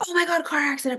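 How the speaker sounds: high mock panic, imitating herself freaking out. Imitating her own panic over the fender bender. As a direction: squeal it like a panicked driver. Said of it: "I'm not gonna be like".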